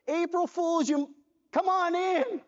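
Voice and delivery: high, mocking boss voice, springing the joke; gleeful prank reveal. Boss springing his April Fools joke on the new hire, high and gleeful. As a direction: crow it like a boss who got you good.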